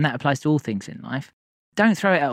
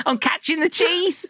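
playful high; 12 semitones higher, slower than usual. Pretend.

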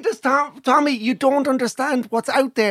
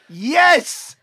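ordinary delivery

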